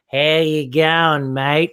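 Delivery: slow, slurred Australian "How you going, mate"; slowed drunk Australian accent. Shown as a demonstration. Explaining the accent's origin, in a slow drunk Australian drawl. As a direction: drag the Australian greeting out like a drunk. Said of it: "a slowed version of it".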